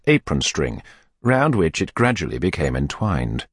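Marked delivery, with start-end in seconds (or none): none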